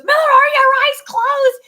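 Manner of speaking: high, loud screamed question on a ride; retold scream. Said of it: "And she goes".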